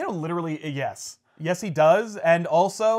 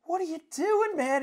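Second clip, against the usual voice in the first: put-on much higher young woman; high concerned girl; 13 semitones higher than usual. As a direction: ask like a worried girl. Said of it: "she's like".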